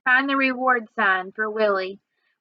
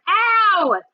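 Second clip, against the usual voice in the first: sharp mock ow; quick hurt yelp; yelp of pain; 7 semitones higher, 4 dB louder than usual. A narrator's reading. Kid yelps at sister's kick, sharp ow. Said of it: "he hollered".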